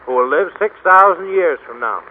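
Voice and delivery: ordinary talk, nothing marked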